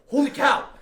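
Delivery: loud, high startled cry; shocked exclamation. Retold or imitated. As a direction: blurt it out in shock. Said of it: "he goes to open the stall".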